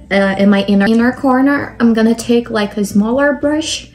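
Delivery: ordinary delivery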